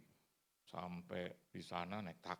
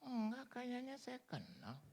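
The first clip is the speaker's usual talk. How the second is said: high, slow, wondering voice; curious old lady; 5 dB quieter, slower than usual. Retold or imitated. Old lady in the lift half-recognizing the preacher, high slow wondering. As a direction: squint and wonder who he is.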